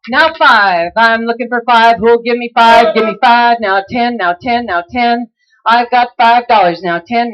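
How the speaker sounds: rhythmic bid chant; auctioneer. Acted. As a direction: keep the bids rolling fast.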